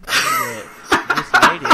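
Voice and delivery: high laughing brag, drawn out; squealed with a laugh. Acted. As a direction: shriek it out mid-laugh. Boasting, voice jumps into squeal.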